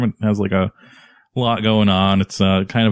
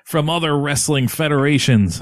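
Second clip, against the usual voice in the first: high-pitched wrestler promo imitation; mock promo voice, pitched up; Owen Hart; 6 semitones higher than usual. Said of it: "and then he's like".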